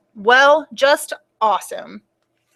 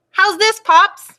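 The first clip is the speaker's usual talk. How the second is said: put-on 1 child voice; 9 semitones higher, 5 dB louder, slower than usual. Ruby tests her volume.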